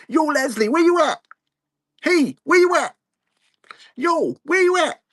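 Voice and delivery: squeaky high call across the room, yo where you at. Pretend. Streamer calling out for Leslie in a high mock voice. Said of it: "I could do a ... button".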